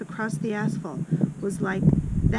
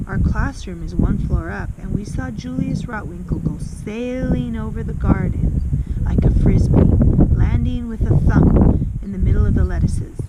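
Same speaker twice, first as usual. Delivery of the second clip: put-on louder, a boy breathless with a wild story; excited telling; 10 dB louder than usual. Boy recounting a classmate flung out the window, louder and excited. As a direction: throw it out big, caught up in the tale. Horatius tells the flinging story.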